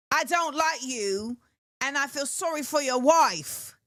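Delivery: put-on blunt, measured British-accented put-down; mock British accent. As the rapper to very specific people, mock British accent. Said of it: "she's talking very specifically".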